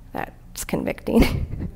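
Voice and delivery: slow, breathy whispered aside with a laugh; hushed, half-laughing confession. Real, not acted. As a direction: let it slip out as a rueful whisper. Repeats the remark, laughing softly.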